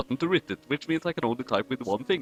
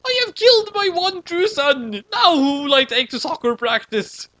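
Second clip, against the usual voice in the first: high, hammed-up wail of a father; high, hammed-up grief; mock grieving scream; 13 semitones higher, 4 dB louder than usual. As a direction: overdo the grieving dad. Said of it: "screamed like a sad dude".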